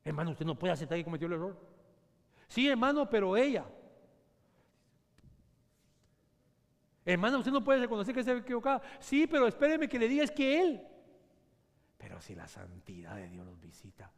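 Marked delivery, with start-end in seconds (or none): 2.5-10.9 high, loud protesting voice; a higher, loud, indignant spouse; defensive spouse. Acted. 12.0-14.1 much softer, whispered; hushed, intimate whisper. Acted. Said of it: "whispered turn".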